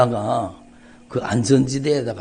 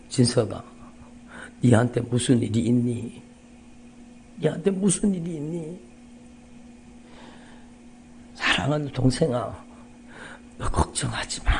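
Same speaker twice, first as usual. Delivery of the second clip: tender sister; 3 semitones lower, 5 dB quieter than usual. Retold or imitated. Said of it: "그 누님이 전화를 하셨는데".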